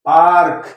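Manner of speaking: "पार्क" said with a long aa; read as park. Shown as a demonstration. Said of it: "पीएआरके इसको पढ़ेंगे".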